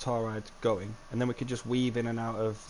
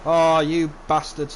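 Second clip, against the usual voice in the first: exasperated; 3 semitones higher, 8 dB louder, slower than usual. Real, not acted. Dinosaurs escaping.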